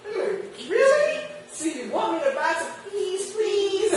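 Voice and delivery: high, laughing, teasing customer; laughing mock disbelief. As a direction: tease the unsure seller while laughing. Customer mocks timid seller.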